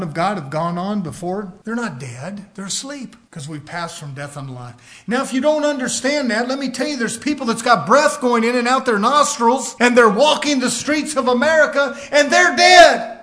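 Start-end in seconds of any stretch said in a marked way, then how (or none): 5.1-13.1 fired-up preacher, ending in a shout; preaching to a climax. Real, not acted. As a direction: hammer the last words from the pulpit.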